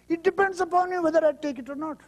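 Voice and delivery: high, loud, quick teacherly voice; Buddha's voice. Acted. Buddha correcting angry Anand, high, loud and quick. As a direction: lift the voice up high to instruct.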